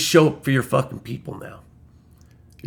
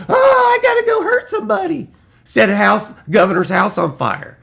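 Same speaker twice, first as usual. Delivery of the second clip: shrill high squeal, fast and unhinged; mock crazed follower; 13 semitones higher, 3 dB louder than usual. Vlogger mocks a violent Trump follower in a high, fast, shrill squeal. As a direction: squeak it out like a cartoon lunatic.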